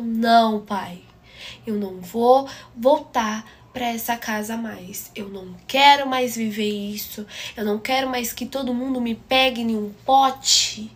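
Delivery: loud, emphatic retold protest; re-enacted row, punching the key words; defiant outburst. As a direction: lay down each phrase forcefully to a parent. Acted quarrel with her father.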